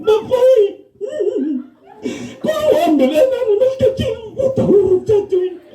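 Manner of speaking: keen like a grieving widow; wailing widow. Retold or imitated. Widow wailing over her dead husband, hammed-up wail. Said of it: "duduk kau menang".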